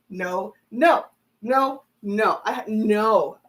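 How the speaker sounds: ordinary talk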